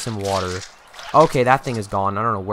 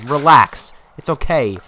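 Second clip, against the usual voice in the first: normal talking voice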